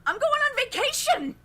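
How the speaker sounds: high, fast, loud shout of joy; excited yell. Acted. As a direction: yell it like you're finally free. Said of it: "and yells".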